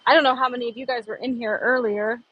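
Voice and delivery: ordinary talk, nothing marked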